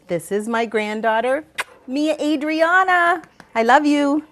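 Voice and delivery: loud, high, drawn-out and adoring; proud, gushing introduction. Real, not acted. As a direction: build to a big loving announcement of your granddaughter. Proudly introduces her granddaughter.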